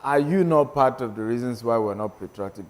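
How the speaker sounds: usual speaking voice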